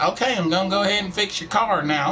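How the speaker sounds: high-pitched country twang imitation; exaggerated country accent. Game character offering a car fix, mock country accent. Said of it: "people talking country".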